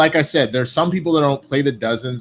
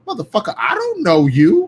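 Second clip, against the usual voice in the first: high-pitched, incredulous mock outrage; acted indignation; 9 semitones higher, 4 dB louder than usual. Veteran reacting to a trash-talking rookie, acted indignation. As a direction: squawk it like you've been insulted by a stranger.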